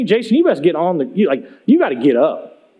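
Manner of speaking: lightly voiced coach, dropped in pitch; low, no-nonsense push to get moving; a hint of a tough coach; coach-like prodding. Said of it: "He coaches me".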